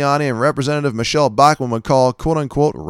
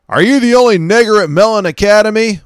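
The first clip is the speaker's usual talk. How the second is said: shouted much higher and louder, a sneering yell from a car; hostile shout; 12 semitones higher, 9 dB louder than usual. Acted. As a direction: yell it out the window at a stranger. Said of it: "one of them shouted".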